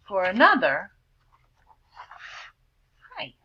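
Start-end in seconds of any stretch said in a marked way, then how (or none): none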